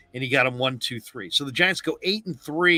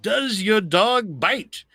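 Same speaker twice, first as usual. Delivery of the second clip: asked again, slow and higher; slow repeated question; 8 semitones higher, 3 dB louder, much slower than usual. Retold or imitated. A guy repeating a question to Peter Sellers, slow and higher. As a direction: spell it out slowly to the slow listener. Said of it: "He's like".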